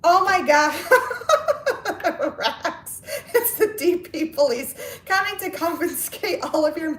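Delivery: high voice breaking up with laughter; laughing while reading. Real, not acted. Host reading a viewer's teasing comment aloud, laughing. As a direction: read it out through real giggles.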